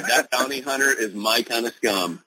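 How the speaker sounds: normal talking voice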